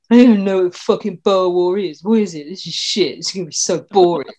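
mimicking a bored friend's complaint; a touch of exaggerated dismissive moaning; mock grumbling. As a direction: grumble it like someone dreading a dull topic. Mimics friend's grumbling before episode.

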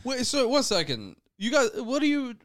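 usual speaking voice

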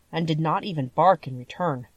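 ordinary delivery, nothing marked

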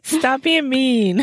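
slow, laughing protest. Real, not acted. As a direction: scold her through real laughter. Laughing at co-host's joke.

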